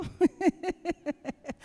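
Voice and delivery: long, rhythmic chuckling; laugh. Real, not acted. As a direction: laugh at your own teasing joke. Laughs at his arepas joke. (risa sostenida)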